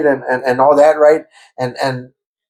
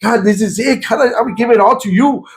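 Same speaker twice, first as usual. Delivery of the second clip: high, pleading cry to God; reliving a tearful plea, voice up high; 6 semitones higher than usual. Retold or imitated. Kneeling at the altar, crying out to God. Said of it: "I'm crying".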